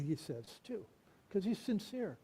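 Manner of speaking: ordinary delivery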